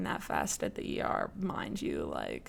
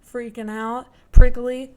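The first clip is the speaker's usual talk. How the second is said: usual speaking voice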